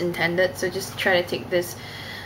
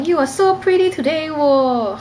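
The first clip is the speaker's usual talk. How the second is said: slow, high singsong Cantonese-accented English; 7 semitones higher, 4 dB louder than usual. Shown as a demonstration. Complimenting someone's looks today, Cantonese accent. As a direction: carry Cantonese tones into the English, drawing out the end. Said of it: "the tone kinda carries over".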